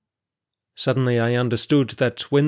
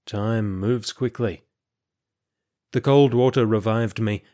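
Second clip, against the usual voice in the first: usual speaking voice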